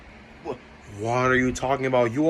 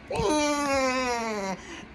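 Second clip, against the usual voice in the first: squealing laugh; 11 semitones higher, 4 dB quieter than usual. Acted. Laughing at the poke.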